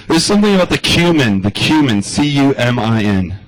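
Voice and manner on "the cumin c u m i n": ordinary delivery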